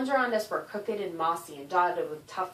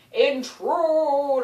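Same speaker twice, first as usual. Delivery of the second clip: slow, loud, high drawn-out monster shriek; turkey monster shriek; 7 semitones higher, 9 dB louder, much slower than usual. Acted. Turkey monster shrieking at the intruders, slow and loud. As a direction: stretch it out like a screeching giant bird. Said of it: "one of the turkey monsters shrieked".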